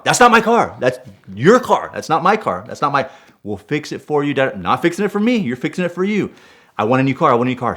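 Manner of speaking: high, insistent angry refusal; heated protest. Retold or imitated. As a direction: reenact arguing back stubbornly, voice jumping up. Acted quarrel with the dealership.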